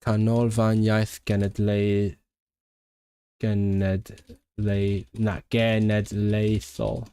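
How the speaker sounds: very slow, piece by piece Welsh; careful sounding out. Real, not acted. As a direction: work through the long name slowly. Sounds out a long Welsh name.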